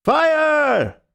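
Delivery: long high yell of alarm; yelled again. A narrator's reading. Group yelling fire a second time, loud yell. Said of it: "I will trouble you once again".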